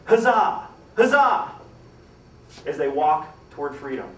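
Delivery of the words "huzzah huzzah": high, loud retold cheer; yelling out. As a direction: call it out loud to the Union lines. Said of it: "they yell out".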